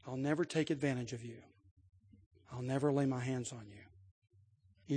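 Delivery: soft, low, tender promise to a frightened slave; gentle promise. Acted. Kind master gently reassuring his new slave, soft and tender. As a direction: speak softly like a kind master reassuring someone afraid.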